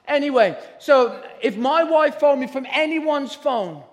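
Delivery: loud, high, fired-up preaching; preaching at full force. Acted. Preacher exhorting the church, loud and fired up. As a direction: drive it home to the whole congregation.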